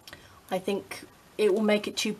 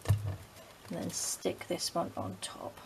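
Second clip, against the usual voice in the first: ordinary talk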